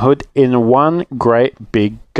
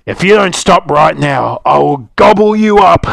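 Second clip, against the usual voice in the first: rising to a loud, higher bellow; 6 semitones higher, 5 dB louder than usual. Acted. Mister Wolf threatening to eat Little Rude, bellowing. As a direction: threaten her, building to a bellow. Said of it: "bellowed Mister Wolf".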